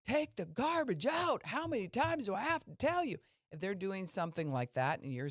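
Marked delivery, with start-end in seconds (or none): 0.1-1.5 high, loud, slow parental yell on "Take the garbage out!"; exasperated parent shouting at a kid. Retold or imitated. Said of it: "someone's telling you". 1.5-3.2 put-on exasperated parent shouting at a kid; yelling parent. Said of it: "someone's telling you".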